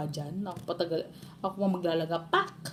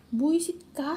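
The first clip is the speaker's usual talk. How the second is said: imitating angry mother; 6 semitones higher, slower than usual. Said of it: "sabi sa akin".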